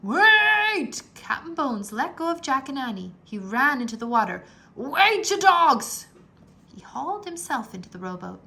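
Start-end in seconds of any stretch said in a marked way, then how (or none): 0.0-1.0 high, loud yell; captain calls after his men; desperate shout. A narrator's reading. Captain chasing boat. 4.8-6.1 high, loud yell; captain calls after his men; desperate shout. A narrator's reading. Captain chasing boat.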